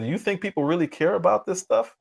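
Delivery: mocking quote. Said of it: "what Karine Jean-Pierre said".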